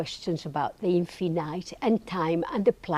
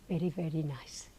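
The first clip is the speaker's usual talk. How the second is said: whisper; 3 semitones lower, 9 dB quieter than usual. Retold or imitated. Guru answers softly.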